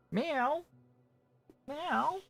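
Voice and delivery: very high soft mock meow; high mewing imitation; cat meow. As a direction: meow like a cat to call her. Calling the cat with meows.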